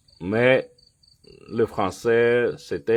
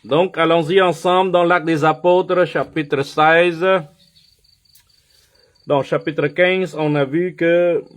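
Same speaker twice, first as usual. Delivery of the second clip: much higher and louder, preaching at full force; 9 semitones higher, 6 dB louder than usual. Acted. Pastor rallying his church to open Acts, full-force preaching. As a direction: call the whole church into the passage. Pastor launches into the Bible reading.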